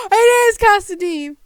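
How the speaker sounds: loud high joyful yell; overjoyed shout; excited shout. Real, not acted. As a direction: crow it out in triumph. Gasp then shout of joy.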